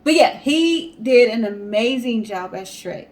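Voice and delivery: ordinary delivery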